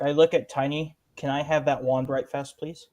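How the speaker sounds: ordinary delivery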